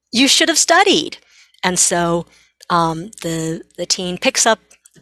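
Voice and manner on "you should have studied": high, loud told-you-so from the mom; scolding mom. Retold or imitated. Mom to her stressed teen, a pointed you should have studied. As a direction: mom lectures her teen, higher and louder. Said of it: "And then mom says, of course".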